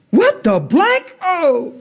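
high, slow, stretched disbelief; hammed-up stunned censored curse; imitated astonishment. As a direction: act floored by a healing. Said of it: "Watch, I ain't never seen this".

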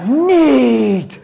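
high, loud laughing exclamation; the young man repeats it in laughing wonder; dazed delight. Retold or imitated. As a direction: marvel out loud, still laughing. Awestruck man after prayer.

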